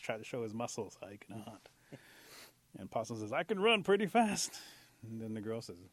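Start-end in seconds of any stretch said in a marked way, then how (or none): 3.3-4.6 drawn-out, high, loud brag; eager boast. Acted. Possum courting the girls, boasting eagerly. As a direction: show off to impress them.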